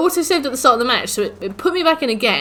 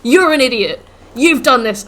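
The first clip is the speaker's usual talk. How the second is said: loud, higher mocking taunt; taunting game; 3 semitones higher, 8 dB louder than usual. The game taunting the player after his death. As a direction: jeer like a smug game. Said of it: "It was like".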